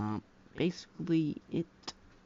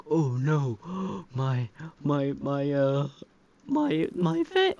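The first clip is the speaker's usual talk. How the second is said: hammed-up gasping panic, louder and rising high on vent; mock distress; 3 semitones higher, 7 dB louder, slower than usual. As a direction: play the robot losing its vent. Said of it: "he's like".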